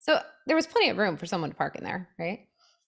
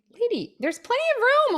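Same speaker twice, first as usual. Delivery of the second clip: exasperated retort; 4 dB louder than usual. Retold or imitated. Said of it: "she was yelling at me".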